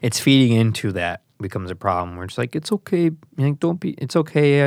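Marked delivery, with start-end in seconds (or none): 2.5-4.6 put-on higher, soothing baby-talk; mildly higher soothing coo. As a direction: coo at a fussing child. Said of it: "when you baby someone".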